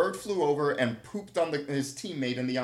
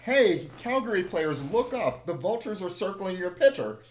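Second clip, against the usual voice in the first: higher, louder heckler's call; retold yell; 4 semitones higher, 4 dB louder than usual. Heckler yells to Calgary players about circling birds, retold yell. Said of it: "I yell out".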